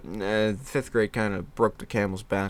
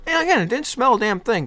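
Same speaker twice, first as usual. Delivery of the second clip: emphatic; 11 semitones higher, 5 dB louder, faster than usual. Real, not acted. Emphatic retelling.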